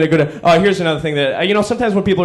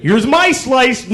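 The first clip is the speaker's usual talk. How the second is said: smug grandfather; 8 semitones higher, much slower than usual. Retold or imitated. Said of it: "he goes".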